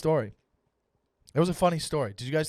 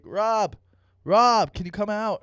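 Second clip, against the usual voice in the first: loud, higher call to a neighbour; 6 semitones higher, 7 dB louder, slower than usual. Retold or imitated. Friends calling a neighbour to come out, calling out. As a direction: imitate someone shouting up to a window.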